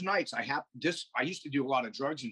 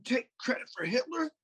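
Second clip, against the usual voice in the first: pitched higher, ranting hard; worked-up rant; 9 semitones higher than usual. Real, not acted. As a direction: get fired up and push the voice higher. Heated rant about Germans.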